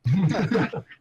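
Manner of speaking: short burst of laughter; a quick, high, loud laugh. Real, not acted. Player laughing at the game table, short high laugh.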